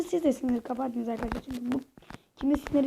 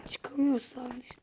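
slow hushed whisper; 7 dB quieter, slower than usual. Real, not acted. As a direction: whisper it slowly to yourself. Kendi kendine oyun yorumu. (fısıltıyla)

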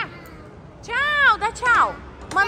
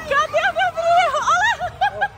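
higher, excited calling; playful calling out; 10 semitones higher, slower than usual. Real, not acted. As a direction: call for him, higher, laughing. Calling out for son.

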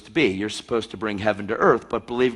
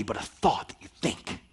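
ordinary talk

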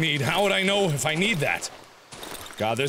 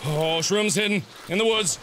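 normal talking voice

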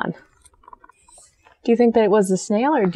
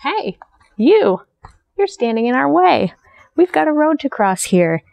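rowdy ants yelling; 6 semitones higher than usual. Acted. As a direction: bark it like a gang of rowdy ants. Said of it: "yelled a troop of rowdy ants".

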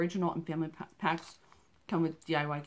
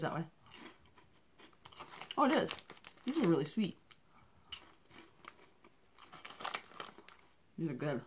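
talking between bites; speaking while chewing; 4 dB quieter than usual. Real, not acted. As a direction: mouth partly full of snack. Seen 2: Puts puffs in mouth at 1.9 and 5.6, chewing while talking.

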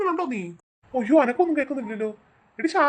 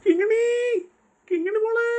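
calling out; 9 semitones higher, slower than usual. Acted. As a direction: call out for the missing girl. Father calling lost daughter again.

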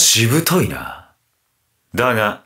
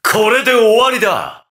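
high, forceful battle call; finishing shout; 8 semitones higher than usual. Acted. Fighter finishing off Kamen Rider, high shout. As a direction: call it out high for the last blow.

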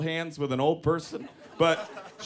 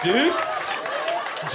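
ordinary delivery

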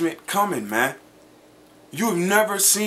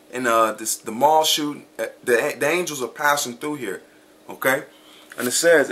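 ordinary talk

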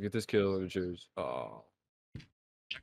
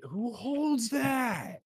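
slow, much higher angry shout; raging gamer; much slower than usual. Real, not acted. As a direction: yell in disbelief at the screen. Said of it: "I'm so gamer rage right now".

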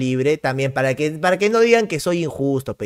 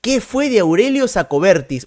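ordinary talk

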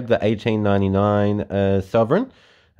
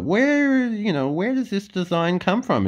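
high mock wonder; 12 semitones higher than usual.

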